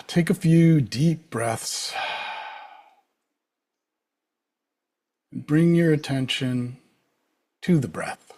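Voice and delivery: calm, unhurried meditation guide with long pauses; spacious, settled instruction; guided meditation. Real, not acted. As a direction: lead them gently into the breath, leaving space between phrases.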